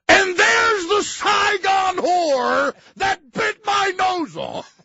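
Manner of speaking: high, slow, hammed-up screaming; furious shout. Farley's character spots the woman and screams. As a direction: bellow it out in mock outrage.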